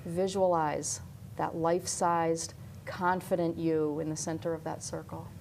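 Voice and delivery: calm hypnosis voice; guided visualization. Acted.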